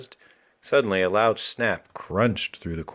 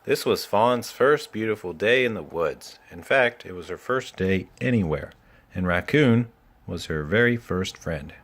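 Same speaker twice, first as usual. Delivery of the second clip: ordinary delivery, nothing marked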